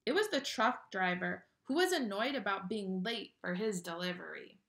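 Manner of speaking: put-on lively read-aloud to children; animated picture-book narration; storybook reading. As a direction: read it like bedtime story time.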